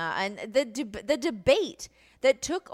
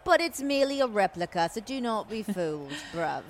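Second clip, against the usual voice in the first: put-on British commentator, laughing a little; mock-British play-by-play; British accent. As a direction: play a proper British announcer calling the scene. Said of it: "describe it for you in British accents".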